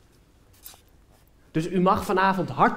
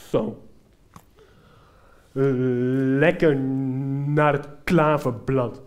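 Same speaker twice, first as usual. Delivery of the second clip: other cyclist; 5 semitones lower, slower than usual. Retold or imitated. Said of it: "Hij draait keurig mee en zegt".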